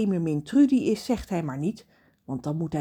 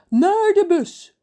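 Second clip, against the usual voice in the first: slow, loud, high call; giant calling out; 13 semitones higher, 10 dB louder, slower than usual. Acted. Giant calling the others to the bus, slow and loud. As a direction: call it out slowly across the harbour. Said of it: "roept Reus".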